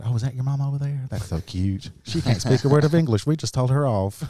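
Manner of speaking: imitated Southern US small talk; Southern US gossip impression; Southern US accent. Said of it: "you had that accent like that".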